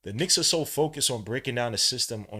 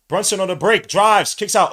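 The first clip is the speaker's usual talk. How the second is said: loud, high call of a fast break; excited play-by-play; 9 semitones higher, 13 dB louder than usual. Real, not acted. Calling a live fast break.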